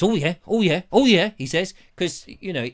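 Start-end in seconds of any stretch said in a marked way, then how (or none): 0.0-1.3 mock Trump. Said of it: "And he goes".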